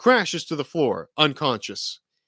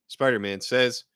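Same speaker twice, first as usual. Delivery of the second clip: ordinary delivery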